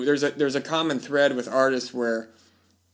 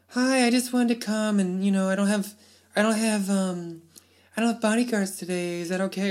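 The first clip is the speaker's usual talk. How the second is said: much higher, timid celebrity imitation; 7 semitones higher than usual. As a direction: play the shy star asking permission, pitched way up. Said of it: "you killing me with that voice".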